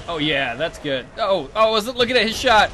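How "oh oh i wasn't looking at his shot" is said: higher, louder and fast; panicked rush. Real, not acted. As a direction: blurt it out in alarm. Getting hit while distracted.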